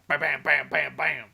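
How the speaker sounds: punchy spoken gunshots; imitated gunfire. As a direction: fire off each bam like a shot. Imitating gunfire in planned shootout.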